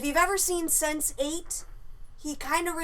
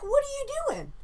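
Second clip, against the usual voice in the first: high, put-on voice for the intruder; Russian intruder; 13 semitones higher than usual. Intruder on the toilet asks the woman, high mock voice. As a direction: toss the question back casually in a high voice. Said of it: "And he's like".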